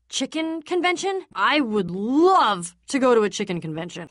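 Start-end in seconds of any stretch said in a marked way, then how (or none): none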